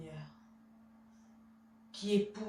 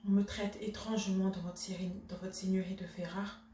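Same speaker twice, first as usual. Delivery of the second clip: soft, low, controlled reproach; restrained indignation; 3 semitones lower, 6 dB quieter than usual. Acted. Wife demanding justice from her husband, restrained indignation. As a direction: hold the offence in, keep it quiet.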